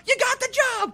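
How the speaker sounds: high, loud goofy yell; goofy Tom Green shout. Retold or imitated. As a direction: bellow the good news like a madman. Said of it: "like, doing voices where he's like".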